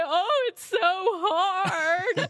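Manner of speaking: high, loud, drawn-out fake sobbing; hammed-up crying, stretching the words out; mock tearful wail. Daughter reenacting her tearful answer to dad, mock wailing. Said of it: "waterworks would start flowing from my eyes".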